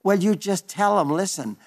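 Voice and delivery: normal talking voice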